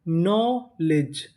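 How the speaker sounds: Knowledge slowly in two stretched parts; syllable by syllable. Shown as a demonstration. Teacher assembles a word for learners, knowledge slowly in pieces. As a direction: hold the first half of knowledge, then add the second. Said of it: "तो meaning क्या बनी?".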